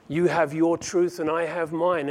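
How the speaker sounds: normal talking voice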